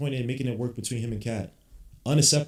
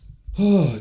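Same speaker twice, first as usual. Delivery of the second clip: yawn; 7 semitones higher, 8 dB louder than usual. Real, not acted. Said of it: "Excuse me for the yawning".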